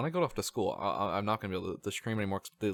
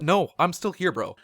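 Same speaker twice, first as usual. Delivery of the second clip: loud, higher retold protest; indignant protest; 7 semitones higher, 6 dB louder than usual. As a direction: protest loudly that you're being ignored. Acted protest to a friend's joke.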